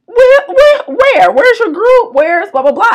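high, loud, sassy questioning. Retold or imitated. Sassy local lady questioning a lone hiker, high, loud and sassy. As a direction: fire off the questions with mock disbelief. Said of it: "Two African ladies with so much sass".

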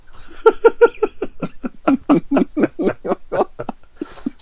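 long, breathy laughing fit; helpless laughter. Real, not acted. Co-host cracking up at his own cover, helpless laughter. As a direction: crack up and keep wheezing.

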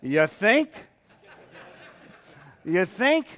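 loud, laughing, rising jab; mock the obvious with a chuckle; sarcastic. Real, not acted. Preacher jokes about Jonathan's late realization, sarcastic laughing jab. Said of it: "I like that last line".

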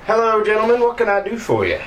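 high-pitched, cheery official greeting; welcoming mayor. Acted. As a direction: greet visitors as an obliging mayor, pitched well up. Game master greets as the mayor.

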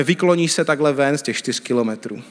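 ordinary talk, nothing marked

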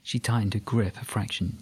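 ordinary delivery

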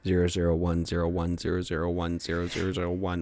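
binary code in a robot voice; low, soft robot reciting zeros and ones; toy robot voice. Pretend. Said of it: "We are robots now".

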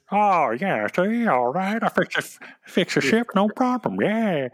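alien mechanic voice. Retold or imitated. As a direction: play a friendly alien fixing ships. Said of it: "Mon Calamari in sweaters".

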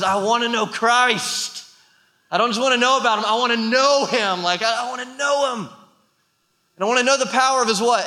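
high, urgent preacher's voice; impassioned preaching. Real, not acted. Preacher rousing the church with Paul's words, impassioned preaching. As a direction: lift the pitch and press each phrase home. Preaches the scripture at full force.

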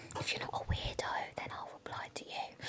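secret aside, breathy whisper; whispered joke just for the listener. Pretend. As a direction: drop to a conspiratorial whisper. Aside about replying to weirdos.

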